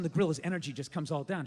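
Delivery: ordinary talk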